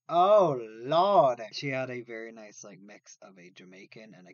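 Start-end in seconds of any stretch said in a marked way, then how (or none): 0.1-1.4 loud, long-drawn swoon. Acted. Confessing he's a sucker for accents, loudly swooning. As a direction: stretch it out in exaggerated rapture.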